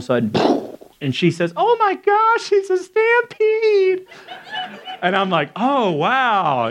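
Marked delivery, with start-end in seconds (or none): 1.6-4.1 very high, drawn-out imitation of amazement; mock excited squeal. Imitating fiancée spotting the stampede, mock excited squeal. 5.6-6.7 high, swooping feigned amazement; mock surprise. Feigning surprise at the stampede he planned, mock amazed.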